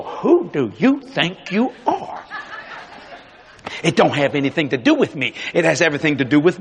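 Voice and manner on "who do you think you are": slow, drawn-out mock challenge; indignant challenge. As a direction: mimic people demanding it, word by word. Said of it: "Then people go".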